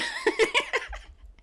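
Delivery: squeaky high-pitched laugh, falling away. Real, not acted. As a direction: let out a high giggle. Laughs after naming Pennsylvania.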